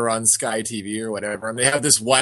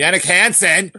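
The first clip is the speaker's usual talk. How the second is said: louder and higher, cheering a missed chance; excited shout; 8 semitones higher, 6 dB louder than usual. Real, not acted. Friend reacts out loud to a hockey chance, excited. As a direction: yell at the replay in disbelief.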